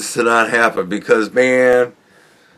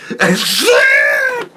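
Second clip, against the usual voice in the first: high shrieking laugh. Real, not acted. Laughing at "are we the baddies.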